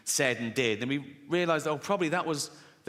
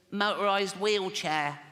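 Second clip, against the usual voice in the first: slow, high, put-on child voice; comic voice of a sickly six-year-old; funny little boy voice; 5 semitones higher, slower than usual. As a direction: play a small boy with a bad chest. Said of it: "a funny little voice".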